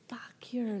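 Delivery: a hushed, seething fuck you; under-the-breath retort; whispered curse. Retold or imitated. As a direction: spit it out in a whisper. Her silent retort to aunt.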